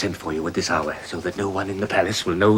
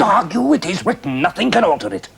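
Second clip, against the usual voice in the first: much higher and louder; forceful command; 11 semitones higher, 9 dB louder than usual. Acted. Father overrides his son's objection, loudly. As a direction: cut off the argument hard.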